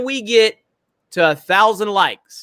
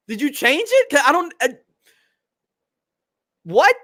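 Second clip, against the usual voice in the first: higher-pitched stammering confusion; acted bewilderment; baffled reaction; 5 semitones higher than usual. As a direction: play a listener who hears no difference. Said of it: "And you're like".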